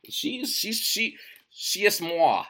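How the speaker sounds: high, slow, mock-grand French flourish; tongue-in-cheek literary snob; mock pretentious. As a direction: savour the moi like a pompous author. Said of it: "I'm sounding fucking pretentious".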